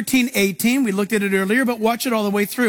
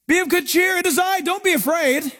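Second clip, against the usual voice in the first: high, carrying call; high-pitched, ringing reassurance; Jesus calling out; 7 semitones higher than usual. Retold or imitated. Voices Jesus calling to the disciples.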